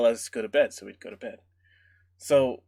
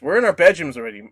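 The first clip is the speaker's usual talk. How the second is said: ordinary delivery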